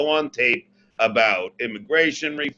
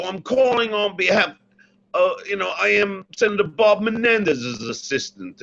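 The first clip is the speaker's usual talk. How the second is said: raised, lightly put-on English accent; 7 semitones higher than usual. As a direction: bluff through it in a fake English accent. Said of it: "listen to that English accent".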